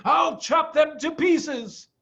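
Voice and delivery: high, slow vicious shout. A narrator's reading. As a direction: yell it with glee. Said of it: "shouted Bunce".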